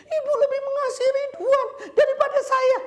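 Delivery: high, loud mock-crying plea; tearful complaint. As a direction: wail it like the hurt secretary. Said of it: "Terus dia ngomong gini".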